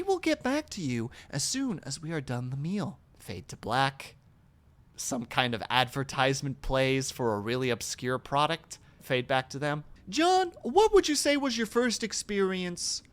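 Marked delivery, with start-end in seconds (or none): 3.2-9.8 lower, flat announcer read of the scene cues; mock TV scene narration; deadpan stage directions. Spoken stage directions again.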